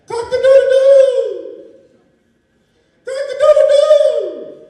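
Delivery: high, drawn-out rooster crow. Acted. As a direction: crow it loud and long like a rooster. Rooster crowing in story.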